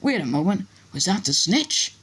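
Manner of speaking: high-pitched running play-by-play; excited match commentary. Acted. Commentator spotting the snitch mid-play, excited. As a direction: call the play, then break off in surprise.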